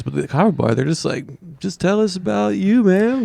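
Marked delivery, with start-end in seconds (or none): 1.6-3.2 high-pitched, casual encouraging voice; imitated easygoing crowd. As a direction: lightly mimic a welcoming open-mic crowd. Acting out the kava bar crowd.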